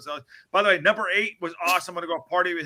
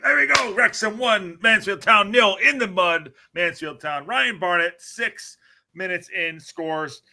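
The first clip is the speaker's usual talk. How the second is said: lively play-by-play call; sports commentary; 3 semitones higher, 3 dB louder than usual. Acted. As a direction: call the highlights like TV. Commentating match highlights video.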